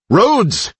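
high, desperate cry of a name; calling out. Acted. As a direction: call out his name in alarm. Calling to a teammate left behind.

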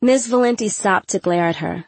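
ordinary delivery, nothing marked

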